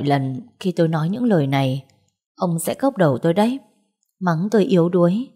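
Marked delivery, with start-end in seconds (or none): none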